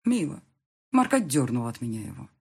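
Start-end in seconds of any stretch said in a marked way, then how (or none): none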